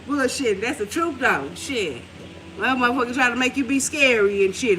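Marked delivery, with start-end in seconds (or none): none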